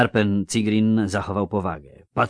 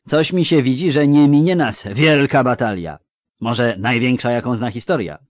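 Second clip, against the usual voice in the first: foreboding dwarf; 5 semitones higher, 9 dB louder than usual. Acted. Voices dwarf Zoltan foreboding.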